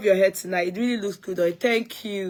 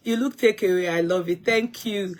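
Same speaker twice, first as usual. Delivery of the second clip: ordinary talk, nothing marked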